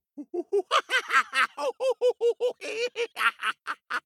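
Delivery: high, bright burst of laughter at the monkeys; delighted laugh. Real, not acted. Monkeys mimic the hat seller.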